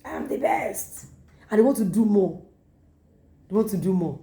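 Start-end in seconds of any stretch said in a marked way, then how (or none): 0.0-1.1 slow, pleased mock wife; smug wife. Preacher imitating a praised wife, slow and pleased. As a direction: savour the praise like a flattered wife. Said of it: "See her head say".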